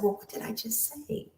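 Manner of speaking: high, hushed, bewildered question; confused. Retold or imitated. Student asking the teacher what she just said, confused. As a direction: trail into a small puzzled near-whisper. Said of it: "I was really confused".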